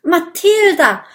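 loud, high glad cry; the dragons greeting their rescuer; joyful call. Acted. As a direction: call her name in relief. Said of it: "rufen die Drachenjungen".

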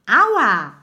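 high, loud yelp; pained yell. A narrator's reading. Mathilda is struck by the thrown head, yelling out high. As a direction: yelp as if suddenly hit. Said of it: "schreit Mathilda".

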